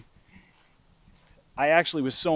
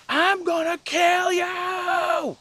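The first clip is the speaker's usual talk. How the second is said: very high, long drawn-out scream; acted furious shriek; much slower than usual. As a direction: scream a threat, stretching every word. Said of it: "And I screamed".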